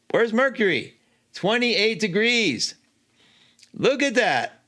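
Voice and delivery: excited discovery. Real, not acted. Excited at finding Mercury.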